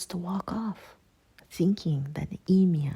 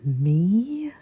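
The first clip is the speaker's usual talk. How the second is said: louder, startled question with a steep rise; incredulous; 2 semitones higher, 11 dB louder than usual. A narrator's reading. Girl told only she can stop it, incredulous. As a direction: blurt it out in disbelief.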